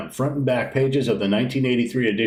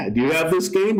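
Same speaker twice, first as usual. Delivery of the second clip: normal talking voice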